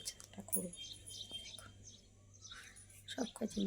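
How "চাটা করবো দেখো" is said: hushed whisper. Real, not acted. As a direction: whisper it so no one wakes. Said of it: "আস্তে করে কথা বলছি".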